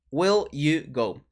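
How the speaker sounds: very slow, each word apart; slow model question. Shown as a demonstration. Teacher showing the inverted question, slow and deliberate. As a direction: lay out the word order slowly. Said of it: "es al revés".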